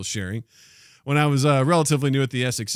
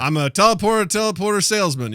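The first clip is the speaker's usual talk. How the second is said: lightly voiced, higher sales pitch; teleporting salesman; 7 semitones higher, 5 dB louder than usual. Salesman hopping into people's homes, lightly hammed-up pitch. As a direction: introduce the trade in a mock salesman voice. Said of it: "Just a naked salesman comes up".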